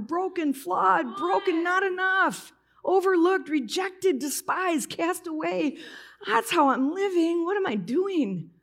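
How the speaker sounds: high-pitched, anguished confession; tearful outpouring. Retold or imitated. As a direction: pour it out as if breaking down. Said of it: "I break into tears".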